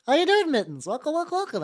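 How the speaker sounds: high, loud chirpy welcome; bright high welcome to a chatter; sing-song greeting. Pretend. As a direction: greet the viewer like a cheery host.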